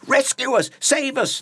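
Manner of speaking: high, loud shriek for rescue; shrieking plea. Acted. Friends begging Jesus to save them, loud shriek. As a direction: shriek it in desperate fear. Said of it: "they shrieked".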